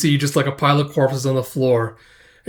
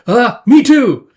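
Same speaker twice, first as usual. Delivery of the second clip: high, loud, drawn-out mock outburst; hurried blurt; 10 semitones higher, 9 dB louder, slower than usual. Brother refusing to let sister go alone, loud blurt. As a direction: blurt out an excuse to tag along.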